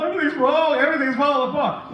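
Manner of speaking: high, loud imitation of a distraught mother; mock crying wail. Distraught mother repeating herself to the preacher, mock crying wail. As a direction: wail it quickly, hammed up. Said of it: "She was crying so vehemently".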